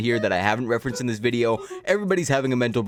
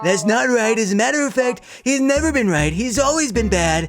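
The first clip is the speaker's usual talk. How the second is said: higher, slow, pompous Trump impression; mock Trump voice; 9 semitones higher than usual. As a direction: puff up like a blustering Trump. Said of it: "if I wasn't using the voice".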